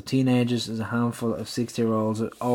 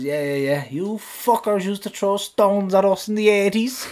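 put-on higher, laughing Athlone accent; a mock Athlone security guard, pitched up with a laugh; 6 semitones higher than usual. Guard teasing the fan about old stones, in a laughing Athlone accent. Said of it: "excuse the accent".

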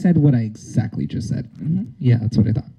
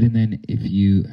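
ordinary talk

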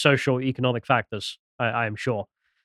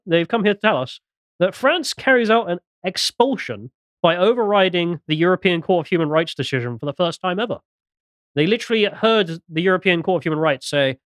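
usual speaking voice

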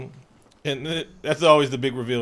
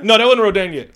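ordinary talk

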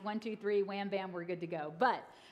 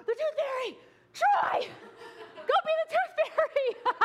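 panicked yell; 6 dB louder than usual. Retold or imitated. Mom panics, yelling husband.

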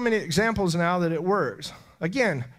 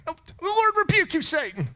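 much higher, louder, fast rebuke; fierce rebuke; 12 semitones higher, 4 dB louder than usual. Real, not acted. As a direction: rebuke the enemy with force. Rebuking Satan aloud.

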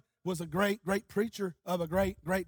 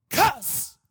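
stuck word bursting out high; Brother Buddy's burst out of a stutter; 7 semitones higher, 6 dB louder than usual. Retold or imitated. Stuttering evangelist names what came out of them, sudden burst. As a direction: let the blocked word pop out suddenly. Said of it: "He just stuttered".

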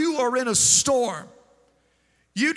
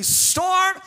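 commanding the storm; 3 semitones higher, slower than usual. Acted. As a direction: order the storm away with full authority. Preacher rebukes the storm aloud.

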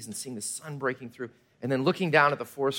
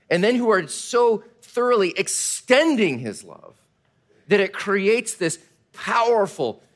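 fervent preaching; 3 semitones higher, 4 dB louder, slower than usual. Acted. Building preaching passage.

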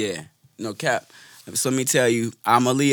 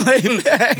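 laughing; 9 semitones higher, 5 dB louder than usual. Real, not acted. Repeat said laughing.

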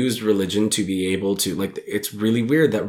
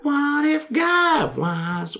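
high falsetto, drawn out slowly; man sings in a silly girly falsetto; girl voice; much slower than usual. Acted. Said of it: "That's my girl voice".

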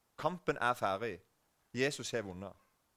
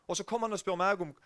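ordinary delivery

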